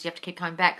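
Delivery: usual speaking voice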